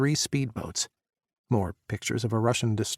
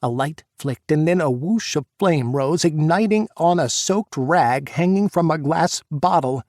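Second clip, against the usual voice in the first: plain reading voice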